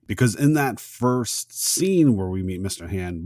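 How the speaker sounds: ordinary delivery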